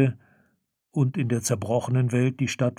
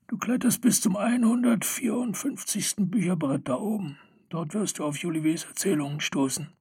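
high, far-off gnome voice; old gnome voice; 6 semitones higher, 4 dB quieter than usual. Acted. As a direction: speak it like a wizened gnome. (hohe Stimme eines alten Gnoms)

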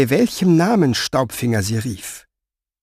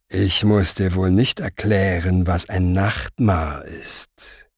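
lower, very slow, softer velvet menace; velvety voice; 5 semitones lower, 3 dB quieter than usual. Acted. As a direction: purr it like a silken threat. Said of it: "sagte Orpheus mit samtner Stimme". (Orpheus droht Staubfinger samtweich, tiefer und sehr langsam)

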